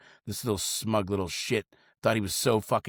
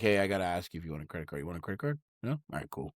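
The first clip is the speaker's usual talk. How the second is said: bored rushed pitch; 5 semitones lower, 7 dB quieter, faster than usual. Retold or imitated. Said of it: "I would just straight up tell 'em".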